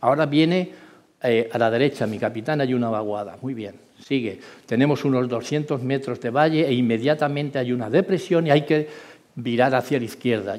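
normal talking voice

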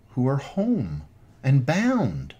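ordinary talk, nothing marked

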